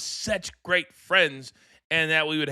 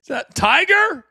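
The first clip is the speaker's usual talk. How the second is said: surprised; 11 semitones higher, 7 dB louder than usual. Real, not acted. Spotting the dog on camera.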